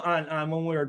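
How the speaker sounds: usual speaking voice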